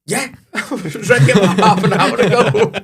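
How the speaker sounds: high, loud, laughing cheeky reply; cocky comeback laughing. Retold or imitated. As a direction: laugh through a cocky boast. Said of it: "I am a little bit like".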